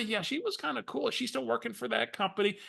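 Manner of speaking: Barbara's voice. Retold or imitated. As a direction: chat it up, pitched high like her. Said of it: "use Barbara's voice for effect".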